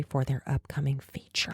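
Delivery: gossipy whisper; hushed whisper. Real, not acted.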